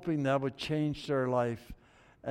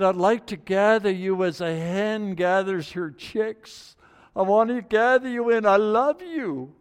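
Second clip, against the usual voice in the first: pleading love; 6 semitones higher, 7 dB louder than usual. Acted. Jesus longing to gather his people like chicks. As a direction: plead with them tenderly.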